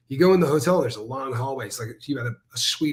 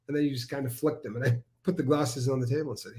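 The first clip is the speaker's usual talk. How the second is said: ordinary delivery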